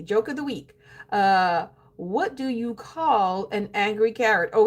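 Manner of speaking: normal talking voice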